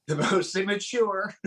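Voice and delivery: slow tease between laughs; amused teasing. Real, not acted. Friend ribbing the youngest of the group, amused and laughing. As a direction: draw the jab out, giggling.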